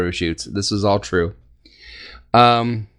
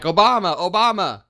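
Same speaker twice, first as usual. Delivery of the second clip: higher-pitched imitation of a quarterback's call; mock line-of-scrimmage yell; 7 semitones higher than usual. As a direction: call it out like a mild mock audible. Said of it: "he's yelling".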